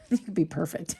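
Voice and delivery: laughing through the words. Real, not acted. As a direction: giggle while saying it. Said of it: "And I'm laughing because I'm".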